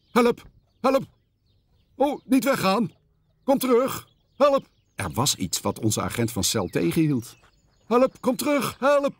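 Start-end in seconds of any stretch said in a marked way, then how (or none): 0.1-4.7 high panicky cries for help; stuck in tar, cry for help; calling for help. A narrator's reading. Vastgeplakt in teer, roept om hulp. 7.9-9.1 high panicky cries for help; stuck in tar, cry for help; calling for help. A narrator's reading. Vastgeplakt in teer, roept om hulp.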